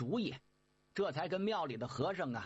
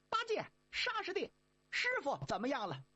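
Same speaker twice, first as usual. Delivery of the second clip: high, quick monkey voice; high-pitched eager Monkey King; 10 semitones higher than usual. Acted. Monkey King asking brothers about master, high and quick.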